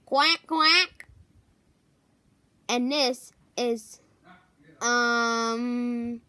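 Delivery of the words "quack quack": a higher, louder quack quack; duck quack. Pretend. Introducing Danny the duck.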